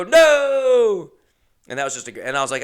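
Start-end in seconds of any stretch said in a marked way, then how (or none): 0.0-1.1 long, very high, loud wail of disbelief; hammed-up dismayed shout; shocked cry. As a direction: cry out in mock horror. Passengers' acted shocked reaction.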